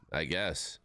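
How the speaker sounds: usual speaking voice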